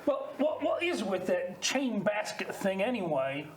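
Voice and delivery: put-on Australian accent for improv; Aussie accent. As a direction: talk like an Aussie bloke. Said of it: "Give us an Aussie accent".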